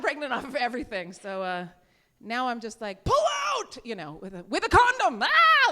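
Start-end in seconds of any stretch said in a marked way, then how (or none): 3.0-3.8 high-pitched, loud panicked command; scared yell. Acted. Comic scared of pregnancy, acting a panicked yell. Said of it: "I'm scared". 4.5-5.7 high, loud mock-terrified cry; scared yell. Comic scared even with protection, panicked yell. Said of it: "I'm scared".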